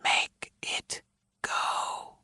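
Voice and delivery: soft whisper, fading away; ghostly whisper. A narrator's reading. Dead wife's voice pleading on the recording, whispered. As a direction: breathe it out like a ghost. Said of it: "The same voice, a female's, says".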